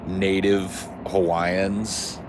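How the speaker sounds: very slow, stretched words; drawn out. Real, not acted. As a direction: drag the words out. Drawn out for comic effect.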